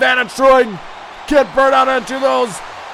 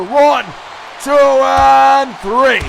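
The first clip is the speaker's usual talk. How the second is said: referee count; 4 dB louder, much slower than usual. Acted. Commentator counts the pin.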